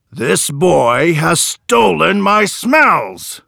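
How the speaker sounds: the man complains to the judge; outraged scream. A narrator's reading. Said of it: "screamed the man".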